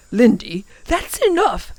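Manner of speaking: understated sharp reprimand; sharp professor. A narrator's reading. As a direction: cut the child off sharply. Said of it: "said the professor sharply".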